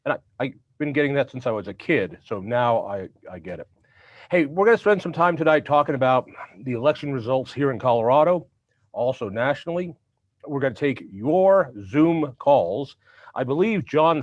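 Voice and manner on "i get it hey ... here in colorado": normal talking voice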